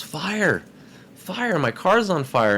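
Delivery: high, urgent mock call of fire; retold yelling for help. Man yelling to neighbors, a high mock alarm call.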